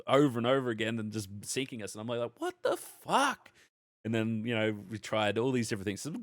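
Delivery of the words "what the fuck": high, drawn-out cry of disbelief; retold outburst, pitched way up and slowed; exasperated disbelief. Gamer recalling being sunk over and over, exasperated disbelief.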